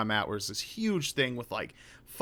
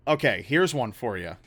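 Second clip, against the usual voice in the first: usual speaking voice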